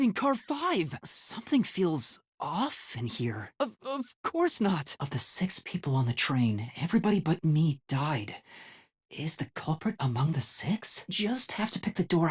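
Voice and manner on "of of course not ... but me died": ordinary talk, nothing marked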